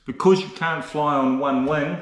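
ordinary talk

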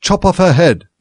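sharp command. Acted. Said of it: "said the Duchess".

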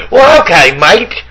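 mocking Irish lilt, tossed off as a joke; mock Irish accent. Mocking Conor McGregor's Irish accent to his cohost. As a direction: tease a gangster by aping his Irish accent. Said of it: "You think that makes you look like a gangster".

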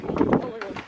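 breathless whisper; whispered awe. Real, not acted. Opening the jacket parcel, a stunned whisper. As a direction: whisper it in disbelief.

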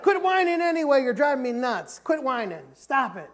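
imitating a fed-up dad, loud and high at first; exasperated scolding. Dad retelling how he told his girls to quit whining, exasperated scolding. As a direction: re-enact telling the kids off loudly.

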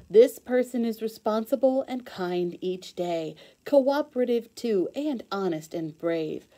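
lively, sing-song storybook read-aloud; reading to children. Acted. Teacher reading a rhyming book to kids, bright storybook voice.

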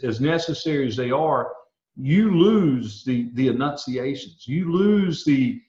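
normal talking voice

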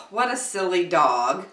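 plain reading voice

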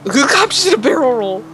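high, laughing exclamation; cracking up at the crash; laughing disbelief. Real, not acted. Cop crash seen in game.

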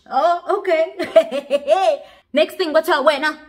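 high, loud laughing disbelief. Real, not acted. Storyteller mocking the heroine's bravado, laughing loud and high. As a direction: laugh it out, can't believe her nerve.

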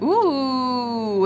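long drawn-out ooh, starting high and gliding down; a long, admiring "ooh"; delighted ooh. Real, not acted. Delighted reaction to a card.

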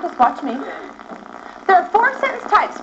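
ordinary talk, nothing marked

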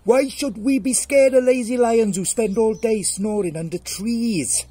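high, full-volume mouse shout. Acted. Mouse shouting into sleeping lion's ear, defiant shout. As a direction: yell it as a tiny defiant mouse. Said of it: "shouted in his ear".

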